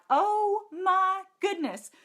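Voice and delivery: high, slow, put-on voice of delight; amazed gasp. Opening her kit and gushing to her viewers, high, slow amazement. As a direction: sing out the wonder of it. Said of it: "I was like".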